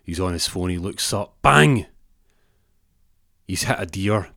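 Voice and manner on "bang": high, explosive burst on one word; sudden loud bang. Acted. Storyteller hits the listener with the crash, sudden loud bang. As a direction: punch it out as the crash.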